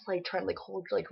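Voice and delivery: normal talking voice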